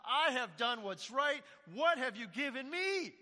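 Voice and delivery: put-on older brother protesting that it isn't fair; ticked off. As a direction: hammer each grievance like a wronged son. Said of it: "He is ticked off".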